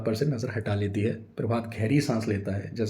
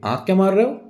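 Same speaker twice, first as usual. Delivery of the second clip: loud, much higher accusation; accusing snap; 10 semitones higher, 8 dB louder than usual. A narrator's reading. Wife catching husband winking at son, loud accusation. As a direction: catch him out sharply.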